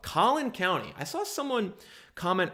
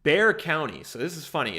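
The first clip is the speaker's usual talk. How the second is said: ordinary talk, nothing marked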